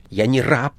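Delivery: growing anger. A narrator's reading. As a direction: bristle and snap back at him. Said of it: "всё более озлобляясь". (с нарастающей злостью)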